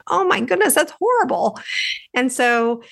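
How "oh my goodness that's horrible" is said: put-on higher, childlike gasp of dismay; shocked little girl. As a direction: play the ten-year-old hearing sad news. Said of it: "a ten-year-old little girl, I was thinking".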